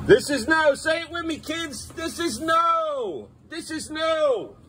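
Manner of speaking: high, drawn-out drilling shout; scolding shout. Real, not acted. Parent making the kids repeat the rule, scolding shout. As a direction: call it out high, dragging the last word.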